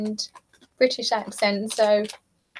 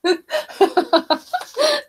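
high burst of real laughter; 11 semitones higher than usual. Real, not acted. Streamer laughing at a viewer's cheap hoodie, high laugh.

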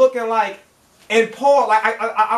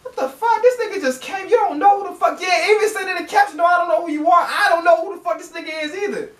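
baffled indignation; 6 semitones higher than usual. Real, not acted. Outraged at the stranger in clip.

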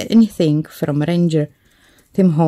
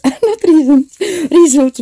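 higher, louder, through a laugh; laughing self-correction; 10 semitones higher, 7 dB louder than usual. Real, not acted. Crafter catching a wrong word on camera, laughing. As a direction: laugh while correcting your own slip.

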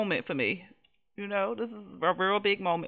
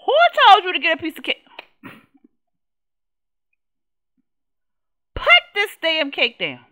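angry mom scolding; 9 semitones higher, 11 dB louder than usual. Acted. Mother scolding over cake.

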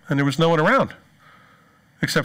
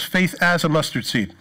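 "as" leaned on, not small; stress on as. Shown as a demonstration. Preacher pointing out the word "as" to the congregation, stressed. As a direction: hit the word "as" firmly. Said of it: "But here he says".

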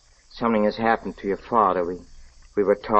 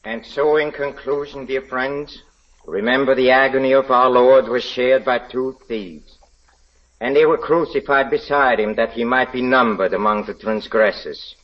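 preaching; 4 semitones higher than usual. Acted. Villain recites his sermon.

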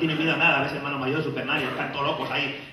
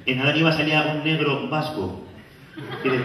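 ordinary talk, nothing marked

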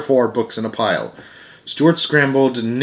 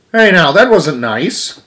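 slow, loud, higher reproach; scolding; 6 semitones higher, 6 dB louder, slower than usual. Acted. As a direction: tell the kids off. Reader scolds the spitballs.

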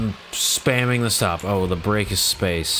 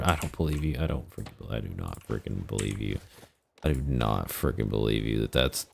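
low disbelief; 9 semitones lower, 6 dB quieter, faster than usual. Real, not acted. Disbelief at sixty hertz monitor.

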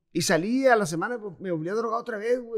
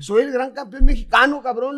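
loud, cocky retold shout of a cornered prisoner; louder mock-furious boast; retold angry boast; 4 semitones higher, 7 dB louder than usual. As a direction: bark it out like the big champion. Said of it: "le digo".

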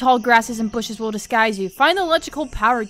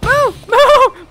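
higher, louder urgent commands; frantic yell; 9 semitones higher, 10 dB louder than usual. Real, not acted. Player stuck while fleeing, frantic yell. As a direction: yell at your stuck character.

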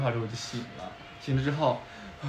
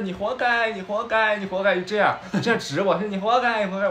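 higher, louder taunting chant; mocking jeer; 7 semitones higher, 6 dB louder than usual. Pointing passers-by taunt the fleeing dreamer, loud and mocking. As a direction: jeer at him like a heckling crowd.

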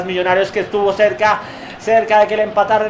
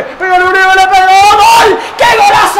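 screaming goal call, high and loud; goal shout; 9 semitones higher, 7 dB louder than usual. Real, not acted. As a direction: yell the goal at full blast.

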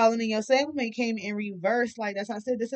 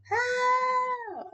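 surprised. Real, not acted. Incredulous at the card.

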